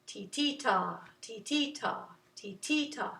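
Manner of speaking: 'titita' with the middle syllable accented; steady chant, ti-TI-ta; push on second ti. Shown as a demonstration. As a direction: lean on the second 'ti', hold 'ta'. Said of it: "second T has a little bit of an extra".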